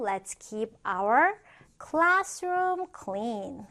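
slow, spaced-out teacher reading with a pause before "classroom"; slow model reading. Shown as a demonstration. Teacher models a classroom example sentence for learners, slowly. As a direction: stretch each word for learners to follow.

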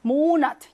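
모나트 with a loud, long 모; stress on first syllable. Shown as a demonstration. Teacher models a pure German word, front stress on 모나트. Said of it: "첫 번째 강세 빡 준다".

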